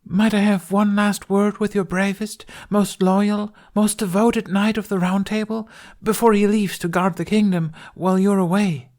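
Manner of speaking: high, pleading Guinevere voice; pleading queen voice. Acted. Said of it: "Tooth pleaded".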